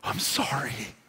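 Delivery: hushed, broken-up plea, almost whispered; low, slow, breath-filled prayer of repentance; imitated crying out to God. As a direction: pray it very softly and slowly, begging. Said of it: "And he cried out to God".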